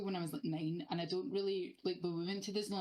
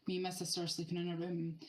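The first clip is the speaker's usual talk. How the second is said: normal talking voice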